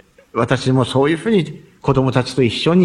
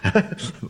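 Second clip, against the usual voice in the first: short soft chuckle to himself; a low, amused laugh after fumbling a word; real chuckle; 3 semitones lower, 6 dB quieter than usual. Real, not acted. Speaker laughs at own stumble.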